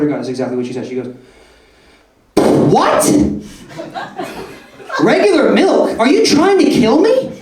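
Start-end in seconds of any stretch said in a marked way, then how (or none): 2.4-7.3 high, loud, appalled shriek; imitating a horrified customer, pitched way up; extreme outraged response. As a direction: overreact as if mortally offended. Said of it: "a bit of an extreme response".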